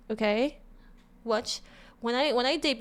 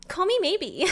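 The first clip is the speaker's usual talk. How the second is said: [name]; 7 semitones higher, 3 dB louder than usual. Retold or imitated. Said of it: "She would say".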